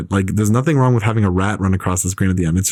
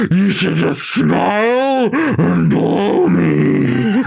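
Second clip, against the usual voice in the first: very slow, higher, leering mock rant; Mel Gibson rant; 8 semitones higher, much slower than usual. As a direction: drag it out like a leering voicemail. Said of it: "Is that from the phone call with his wife?".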